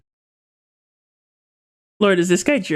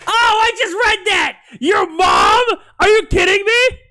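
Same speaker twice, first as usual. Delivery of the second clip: much higher, louder outraged disbelief; incredulous yelling; 11 semitones higher, 3 dB louder than usual. Real, not acted. Streamer yells back at a chat joke, incredulous. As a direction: yell as if you cannot believe it.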